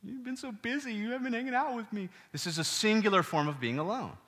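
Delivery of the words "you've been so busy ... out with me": higher, much softer, whiny mock-lonely Adam. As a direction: play Adam as a sulky, neglected kid, hushed and high. This is not Adam crying.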